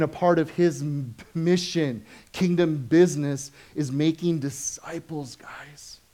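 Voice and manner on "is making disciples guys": hushed emphatic whisper to the congregation. Real, not acted. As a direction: drop to an intense whisper. Stressing the key point.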